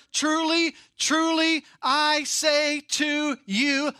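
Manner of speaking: Truly, truly high and loud, I say to you slow; double emphasis. Shown as a demonstration. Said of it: "He goes double emphasis here".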